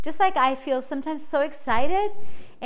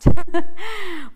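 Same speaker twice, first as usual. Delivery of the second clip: a quick high laugh, louder; bright burst of laughter; 6 semitones higher, 12 dB louder than usual. Real, not acted. Podcast host laughs at her own plea for support.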